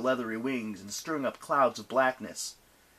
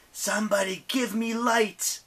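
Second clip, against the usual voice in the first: screaming; 11 semitones higher, 4 dB louder than usual. Acted. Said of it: "Nyx screamed".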